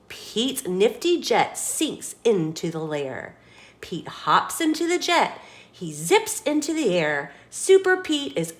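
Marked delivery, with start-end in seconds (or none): none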